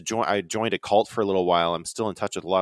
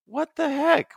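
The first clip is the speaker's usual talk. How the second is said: high startled exclamation; surprised; slower than usual. Retold or imitated. Seeing crowds drive down the mountain, high and startled. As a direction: blurt it out in disbelief.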